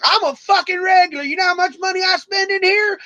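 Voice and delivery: high mock indignant whine; entitled regular. DJ imitating angry regular, high indignant. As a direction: play him as an offended big spender.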